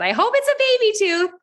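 high, bubbly friendly mom voice; imitating a sugary-sweet stranger on Facebook; mother of the other boy apologizing, high and bubbly; chirpy stranger mom. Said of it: "and she said".

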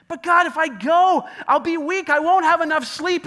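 high, loud whine; whiny protest. Acted. Preacher acting himself whining loudly at God. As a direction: whine like a tired child.

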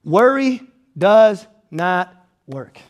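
each word weighed, slow and low; slow firm declaration; slow emphatic statement. Acted. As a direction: lay it down word by word. Preacher's punchline.

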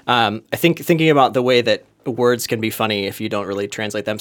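usual speaking voice

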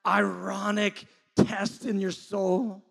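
high, very loud preacher's voice; impassioned preaching. Real, not acted. Preacher urging a torn soul to know God, impassioned. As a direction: preach it at full force.

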